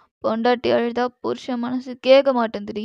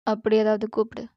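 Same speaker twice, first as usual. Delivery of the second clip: ordinary delivery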